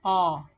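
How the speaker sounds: अ loud and long as open "aw"; vowel sound demo. Shown as a demonstration. Teacher shows only the vowel sounds, loud अ. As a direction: belt out अ as a pure vowel. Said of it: "सिर्फ vowel की sound देगा".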